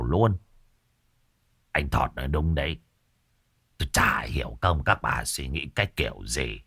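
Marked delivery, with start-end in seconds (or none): 1.7-6.6 lightly voiced grumbling old man. A narrator's reading.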